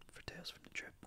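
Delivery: hushed whisper, barely there; whispered show name. Pretend. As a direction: drop to a secretive whisper.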